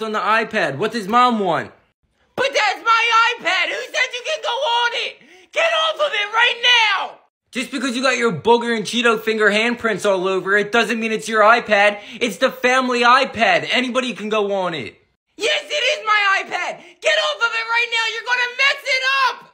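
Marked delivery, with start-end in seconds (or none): 2.4-7.2 put-on high, shrill yelling boy; angry kid shout. Kid shouts at his brother. 15.4-19.4 put-on high, shrill yelling boy; angry kid shout. Kid yells over the iPad.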